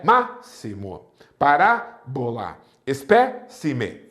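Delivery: "Máximo, parábola, espécime" slowly, MÁ, RÁ and PÉ punched; antepenultimate stress. Shown as a demonstration. Teacher demonstrating proparoxytones, third-last syllable stressed. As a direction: hit the third-last syllable, pause, then let two fall away. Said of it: "possuem duas sílabas depois da tônica".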